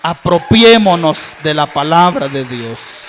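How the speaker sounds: rising fervor. Acted. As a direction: press the call home, stronger. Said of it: "repeated louder".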